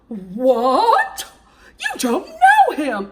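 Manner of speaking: high, loud hammed-up gasp of fake shock; mock fake shock. Mocking Jasmine's fake shock at the engagement news, high and loud. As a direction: overact a badly faked surprise, laughing. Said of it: "tries to act shocked".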